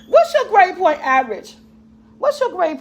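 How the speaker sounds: loud question called across the room; calling out. Real, not acted. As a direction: shout the question to the next room.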